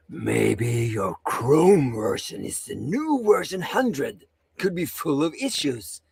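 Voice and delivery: low, slow read-out in another man's voice; John's voice. Acted. Co-host showing how a viewer's comment should sound, in a deep slow voice. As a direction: drop deep and take it slowly. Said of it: "That's not John's voice".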